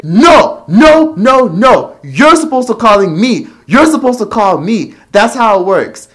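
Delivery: loud, high mock shouting mother; insistent mom. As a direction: yell the nos up high like a mother laying down the law. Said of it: "she would say".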